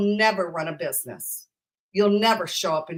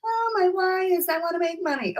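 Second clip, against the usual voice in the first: mock shallow; 9 semitones higher than usual. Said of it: "We're always kind of surfacey".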